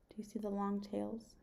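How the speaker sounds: plain reading voice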